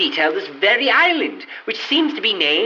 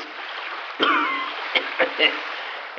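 dry rasping cough; 7 dB quieter than usual. Acted. Waking up half drowned.

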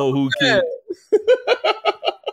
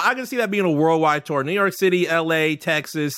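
usual speaking voice

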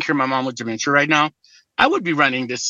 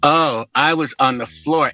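ordinary delivery

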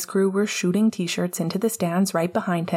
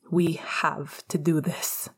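hushed plea close to his ear; urgent whisper; 4 dB quieter, slower than usual. Acted. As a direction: breathe it like a secret. Tense secret plea before kiss.

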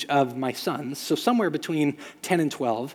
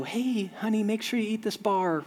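a lightly voiced mom, higher and softer; sweet mom voice; 6 semitones higher, 5 dB quieter than usual. Mom at the dugout fence, lightly voiced sweet coo. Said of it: "moms can walk up and go".

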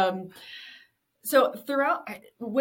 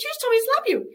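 much higher, louder mock protest; incredulous retort; 6 dB louder, faster than usual. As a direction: play innocent, squeaky and loud. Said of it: "I was like".

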